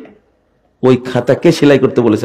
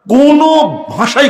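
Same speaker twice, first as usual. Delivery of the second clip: emphatic shout; 14 semitones higher than usual. Real, not acted. Preacher rejecting every language, shouting কোন. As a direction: hit কোন with a sudden shout.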